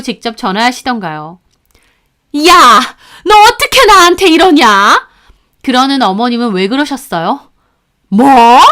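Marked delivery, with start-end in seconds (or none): 2.5-5.1 high, loud indignant yell; outraged mother-in-law. Retold or imitated. 8.1-8.6 outraged snap. Retold or imitated. Mother-in-law outraged.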